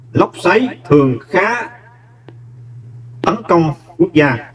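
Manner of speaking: slow, careful, each word spaced, pitch a little up; slow dictation. Shown as a demonstration. Teacher reading the answer sentence slowly to students.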